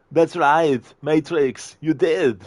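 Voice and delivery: nervous thug clinging to hope; relieved henchman. Retold or imitated. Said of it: "Arnie movie scene impression".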